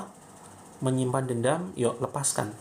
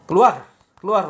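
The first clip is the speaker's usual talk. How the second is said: loud, high shouted command; real snap; 6 semitones higher, 9 dB louder than usual. Shown as a demonstration. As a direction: shout it hard at the jinn. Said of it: "boleh mentak jin".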